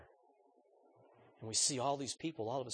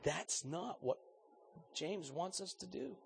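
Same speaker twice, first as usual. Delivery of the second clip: normal talking voice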